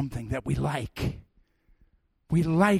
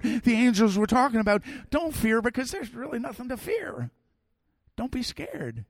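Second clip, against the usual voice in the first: normal talking voice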